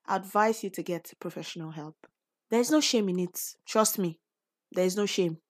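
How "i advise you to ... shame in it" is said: normal talking voice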